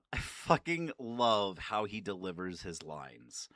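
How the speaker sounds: normal talking voice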